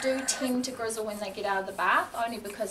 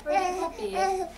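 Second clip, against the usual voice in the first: raised voice over crying; 11 semitones higher, 7 dB louder than usual. Real, not acted. Talking over crying baby.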